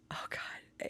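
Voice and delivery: breathless horrified whisper; hushed dread of the spider. Retold or imitated. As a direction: whisper it like it's still there. Recalling the scary spider.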